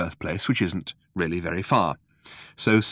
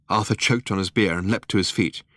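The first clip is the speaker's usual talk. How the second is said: ordinary delivery, nothing marked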